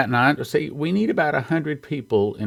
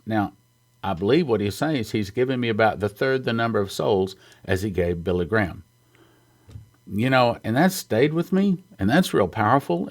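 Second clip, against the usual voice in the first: normal talking voice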